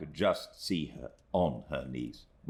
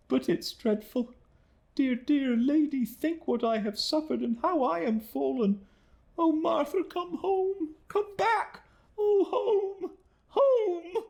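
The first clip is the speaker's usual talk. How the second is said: put-on much higher, louder, breaking young woman calling for home; despairing plea; 4 dB louder than usual. Emily breaks down.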